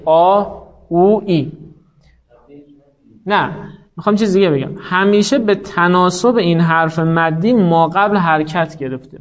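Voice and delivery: normal talking voice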